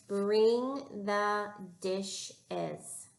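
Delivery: each word of "Bring the dishes" held long; slow careful reading. Shown as a demonstration. Teacher showing a new sound to the class, slowly. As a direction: read it slowly, leaning on "Bring". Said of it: "Read it with me".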